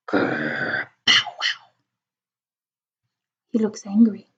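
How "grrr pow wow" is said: low growl then soft woofs; dog growl and bark. Retold or imitated.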